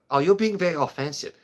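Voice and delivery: high, prissy mock offense; mock offended voice.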